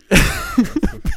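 quick high laugh; short laugh. Real, not acted. Laughing while recounting the magnet stunt. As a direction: burst into a short giggle. Laughing at the cart narc story.

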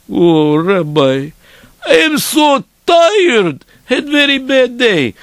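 slow, drawn-out mock whine; weary complaint. Tired dreamer begging off the rabbi, weary complaint.

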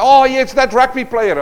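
mock naive answer. Mocking a candidate's naive answer.

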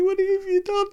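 high mock-weeping wail; imitating tearful mum. Mum sees her son's shaved head, mock tearful wail. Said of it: "She just started crying right there".

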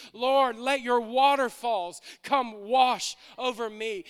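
slow fervent appeal in a preacher's voice; calling out to God. Acted. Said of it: "deep is calling out to deep".

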